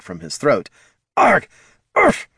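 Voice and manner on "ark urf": sharp, high choking barks; barking cough. Acted. Kobold choking on a cigarette, barking coughs. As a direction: hack it out like something's stuck in your throat. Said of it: "could only manage a barking cough".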